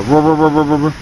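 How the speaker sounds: rolling rrr hum like a worn bearing; bearing noise imitation. Showing viewers what cupped tires sound like, rolling growl. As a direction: buzz it out like a tire droning on the road. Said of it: "It'll almost sound like a bearing noise".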